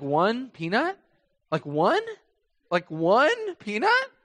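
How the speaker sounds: rising, incredulous, drawn-out last question; the manager can't believe it's one peanut; amused disbelief. Retold or imitated.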